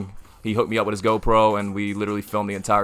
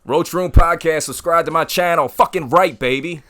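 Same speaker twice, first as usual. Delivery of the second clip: hype shout; 8 semitones higher, 6 dB louder than usual. Acted. Hyped podcast plug outro.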